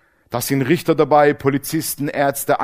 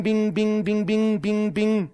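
mock bing sounds; 6 semitones higher, 4 dB quieter, slower than usual. Curse hitting him, imitated noise.

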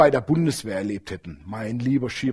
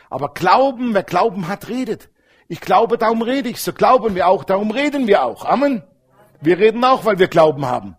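full-force preaching; 4 semitones higher, 4 dB louder than usual. Acted.

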